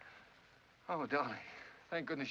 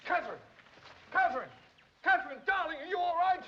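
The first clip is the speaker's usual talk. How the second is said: calls rising higher and louder; frantic calling, building; 4 dB louder than usual. Acted. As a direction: search for her, calling ever more anxiously. Searching for her after crash.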